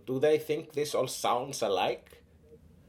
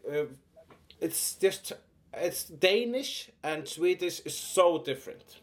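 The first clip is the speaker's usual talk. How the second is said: ordinary talk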